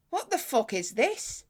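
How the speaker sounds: slow, puzzled imitation of the fella; baffled tech bloke. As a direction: draw it out like he's never seen one. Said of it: "He goes".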